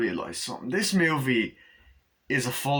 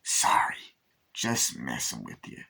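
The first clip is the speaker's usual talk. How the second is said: whispery, low teasing aside; slow, hushed "just kidding" in a put-on voice; imitating Chucky; 11 semitones lower, slower than usual. Chucky to Glenn after the scare, hushed impression.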